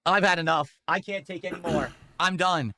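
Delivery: high-pitched, fed-up proclamation; exasperated declaration. Real, not acted. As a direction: declare you are done, pitch climbing on the last part.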